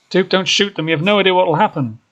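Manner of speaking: high, loud, hurried plea; panicked, high-pitched begging; urgent plea. Acted. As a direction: plead desperately to stop a shooting. Begging Duke not to shoot.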